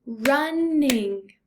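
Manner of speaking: "Running" stretched over two long beats; syllable by syllable. Shown as a demonstration. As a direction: sound out "Running" as run, ning. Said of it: "tap the syllables out".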